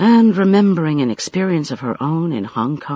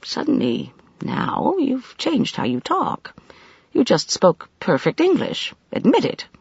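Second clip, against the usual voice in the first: mildly accusing, with higher jumps in pitch; 9 semitones higher than usual. A narrator's reading. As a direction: call out his pretence, lightly. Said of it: "she said accusingly".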